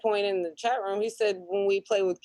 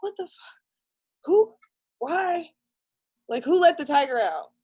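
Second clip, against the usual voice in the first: shocked disbelief; 10 semitones higher than usual. Retold or imitated. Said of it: "I'm like".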